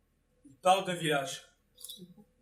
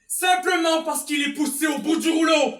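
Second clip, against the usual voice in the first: rising shout; 11 semitones higher, 9 dB louder than usual. Acted. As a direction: voice climbing to a shout. Writer threatened, rising outburst.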